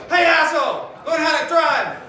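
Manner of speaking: road rage shout. Pretend. Guy pulling up beside the car, shouting. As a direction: bark it at the bad driver. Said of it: "be like".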